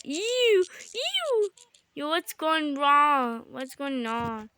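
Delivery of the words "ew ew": high-pitched "ew" of disgust; disgusted squeal. Real, not acted. Player recoiling from something popped in the game, disgusted squeal. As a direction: squeal it, much higher than usual.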